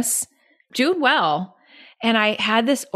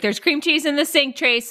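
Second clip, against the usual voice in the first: mock anxious complaint; 7 semitones higher than usual. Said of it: "I'm like".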